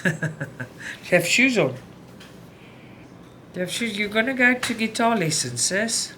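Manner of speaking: high, teasing question to her daughter; mother teasing her dancing daughter about shoes; playful to daughter. Real, not acted.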